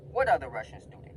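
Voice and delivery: full-voiced, incredulous question; open demand. Student called back in, asking the principal outright. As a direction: put it to him straight out, punching the start. Confronting the principal openly afterwards.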